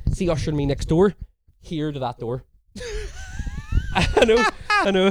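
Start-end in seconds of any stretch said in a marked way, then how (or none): 2.8-5.1 high, slow words through laughter; helpless laughter. Real, not acted. Storyteller admitting his story is ridiculous, laughing helplessly. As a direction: wheeze it out while cracking up.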